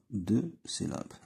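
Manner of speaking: Deux syllabes said very slowly, deux stressed; slow syllable count. Shown as a demonstration. Teacher counting syllables for pupils, very slow. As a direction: stretch out Deux syllabes for children.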